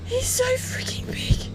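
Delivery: high, slow whisper of awe; awed whisper. Real, not acted. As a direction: breathe it out in disbelief. Awed over huge fish.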